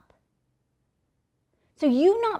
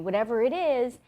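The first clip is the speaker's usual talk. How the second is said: usual speaking voice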